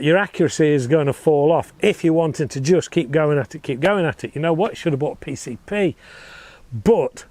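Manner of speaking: ordinary delivery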